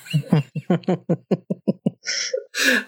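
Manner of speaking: long, high-pitched chuckle; real amused laugh. Real, not acted. Host relishing the punch in the face, amused laugh. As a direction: laugh heartily at your own story. Amused by own favorite scene.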